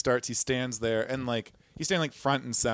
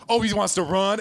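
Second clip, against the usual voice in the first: much higher, louder mock alarm; alarmed exclamation; 8 semitones higher, 5 dB louder than usual. Security spotting the man bolting, alarmed. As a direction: call it out as the chase starts. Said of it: "they're all like".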